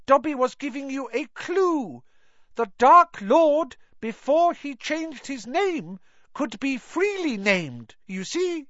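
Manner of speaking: high-pitched elf voice; high elf spelling it out; Dobby explaining. A narrator's reading. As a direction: explain earnestly in a high creature voice.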